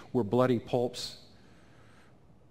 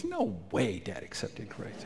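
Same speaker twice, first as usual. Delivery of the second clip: hushed disbelief; 3 semitones lower, 6 dB quieter, slower than usual. Retold or imitated. Brother disbelieving, retold.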